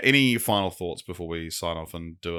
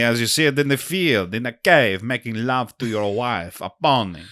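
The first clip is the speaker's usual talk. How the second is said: French waiter, worked up and rhapsodic; thick French accent; 5 semitones higher, 7 dB louder than usual. Acted. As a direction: rave about the pony in a thick French accent. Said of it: "The waiter has a very thick French accent".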